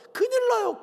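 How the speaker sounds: high, loud sudden alarm; alarmed warning. Real, not acted. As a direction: warn sharply, voice jumping up. Preacher's warning. (깜짝 놀란 듯 높고 크게 경고)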